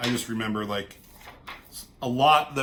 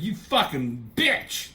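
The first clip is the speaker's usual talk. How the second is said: mock furious curse; 5 semitones higher, slower than usual. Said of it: "and she's like".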